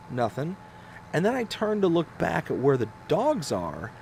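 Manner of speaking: usual speaking voice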